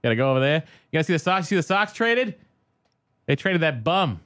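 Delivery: high-pitched Boston sports fan; Boston accent. Shown as a demonstration. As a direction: gripe about the team in thick Bostonese. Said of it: "do the Boston accent".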